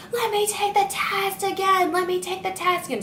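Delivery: high, whiny mock tantrum plea; mimicking the daughter's scream. Girl begging the trooper, high whiny mock scream.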